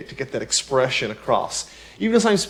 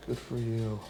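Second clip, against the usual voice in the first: much softer, flat and lowered; deadpan dismissal; 4 semitones lower, 14 dB quieter than usual. Retold or imitated. Friend replying to excited news, deadpan and cold. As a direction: brush it off, unimpressed. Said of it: "it just doesn't really add up".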